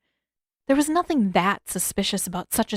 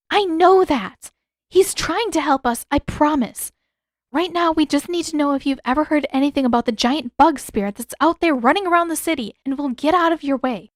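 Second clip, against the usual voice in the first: urgent; 7 semitones higher, 4 dB louder than usual. A narrator's reading. Katara pleading defensively.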